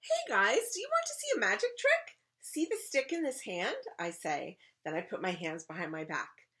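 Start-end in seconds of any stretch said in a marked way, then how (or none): 0.0-3.8 put-on high, loud excited kid voice; child magician pitching to an audience; eager show-off. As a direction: grab everyone's attention for a trick. Child shows off magic trick.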